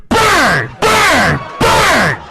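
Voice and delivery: very loud, high bursts, one per word; violent banging. Retold or imitated. Comic imitates woman slamming into him, shouting each impact. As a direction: bellow each "bang" as a blow. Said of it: "backing herself into me really hard".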